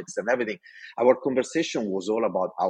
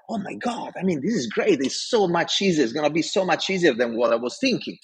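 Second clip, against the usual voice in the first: higher, eager burst of relief; excited gush; 6 semitones higher than usual. Retold or imitated. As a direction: gush like everything just got easy. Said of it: "and I'm like".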